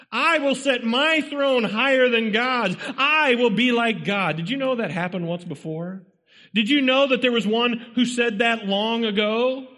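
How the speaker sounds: high-pitched, driving sermon delivery; urgent pulpit exhortation; impassioned preaching. Real, not acted. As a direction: preach it hard, pressing each point on the congregation. Preaches at full force.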